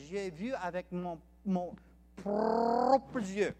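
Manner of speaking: emphatic West African French, R rolled hard. Retold or imitated. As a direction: roll the R like an African French speaker. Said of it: "I'm gonna do the African rolled French".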